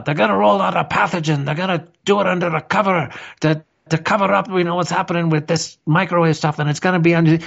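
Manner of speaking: high, quick mimicry of an alarmist; Mark Steele impression. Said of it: "if you had said to us".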